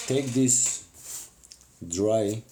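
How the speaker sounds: ordinary talk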